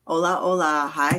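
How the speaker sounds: ordinary delivery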